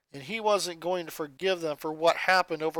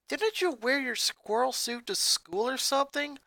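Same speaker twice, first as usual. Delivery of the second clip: bit disinterested; 7 semitones higher than usual. Acted. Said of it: "a bit disinterested as she continued texting".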